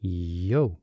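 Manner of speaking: ordinary talk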